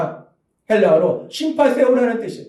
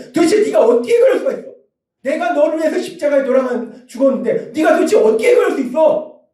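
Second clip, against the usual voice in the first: mock scolding shout; 7 semitones higher than usual. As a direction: shout the accusation at the sinner. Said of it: "비판하고 정죄하시는 것이 아니라". (높고 빠르게 몰아세우는 호통)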